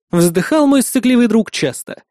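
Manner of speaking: ordinary delivery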